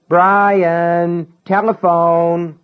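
put-on high, loud child's call, drawn out; calling upstairs. Little brother calls Brian down to the telephone, loud. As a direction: call him down to the phone.

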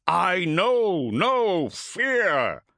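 very slow, loud, high declaration; slow defiant roar. Acted. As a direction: drag out every word in defiance. Cestus walks into the sun.

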